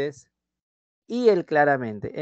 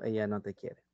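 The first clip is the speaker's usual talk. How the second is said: low, soft Batman-like telling "Ella no te quiere"; Batman telling Robin; 4 semitones lower, 8 dB quieter than usual. Pretend. Batman breaking the news to Robin, low and soft. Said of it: "Y yo te estoy diciendo".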